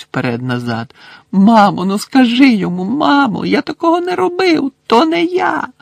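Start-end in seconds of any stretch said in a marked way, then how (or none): 1.3-5.7 very high, loud frantic begging; desperate pleading. A narrator's reading. Son begging his mother to stop Crouch. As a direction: plead hysterically.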